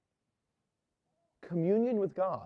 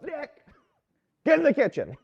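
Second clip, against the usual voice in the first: curt order; 10 semitones higher, 5 dB louder than usual. Retold or imitated. Priest ordering waiter back.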